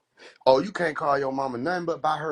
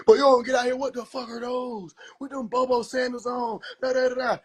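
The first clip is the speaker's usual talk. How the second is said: mocking roaster; 8 semitones higher than usual. Roaster mocking Jesus' sandals.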